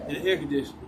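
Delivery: normal talking voice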